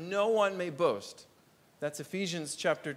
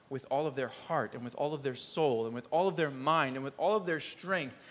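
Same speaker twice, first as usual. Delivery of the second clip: normal talking voice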